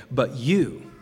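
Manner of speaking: "you" leaned on hard; stress on you. Retold or imitated. Jesus pressing the disciples, "you" stressed. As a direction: put the weight on "you". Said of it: "The "you" here is emphatic".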